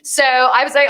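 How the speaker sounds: ordinary talk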